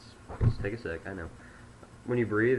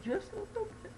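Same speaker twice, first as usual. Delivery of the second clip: much higher squeaky voice; raised pitch; 6 dB quieter than usual. Shown as a demonstration. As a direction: jump the pitch up high. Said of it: "raise the pitch just a little bit".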